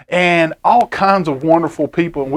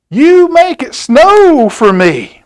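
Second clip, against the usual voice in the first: put-on high, loud and slow child's plea; little girl voice; 9 semitones higher, 6 dB louder, slower than usual. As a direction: ask sweetly like a small girl to her friend. Girl talks to her snowman.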